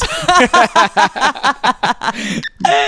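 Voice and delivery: loud, high peals of laughter; loud laughing fit. Real, not acted. Cracking up over AI model joke, loud laughter. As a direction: burst out laughing helplessly.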